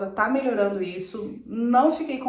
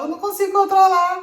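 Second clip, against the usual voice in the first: much higher, louder mock desperate begging; retold crying bride, pitched way up and shouted; tearful plea; 11 semitones higher, 6 dB louder than usual. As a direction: replay the frantic plea through tears, half laughing at herself. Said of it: "eu falava".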